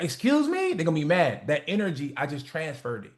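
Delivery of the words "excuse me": high offended excuse me; offended surprise. Retold or imitated. Stranger in the elevator reacting to an insult, offended. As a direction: act shocked and insulted. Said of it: "They're gonna be like".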